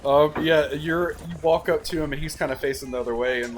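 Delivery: usual speaking voice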